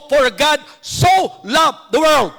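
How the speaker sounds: so leaned on, slow and firm; slow "For God so loved the world" with weight on "so"; stress on so. Shown as a demonstration. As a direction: lean hard on the word "so". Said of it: "Lagi kong binibigyan ng emphasis yung two-letter word na "so".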